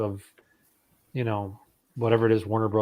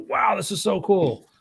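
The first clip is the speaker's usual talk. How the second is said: high-pitched mock-amazed wow; imitated awe; 10 semitones higher than usual. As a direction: play an impressed fan turning to a friend. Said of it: "turn to his friend and go".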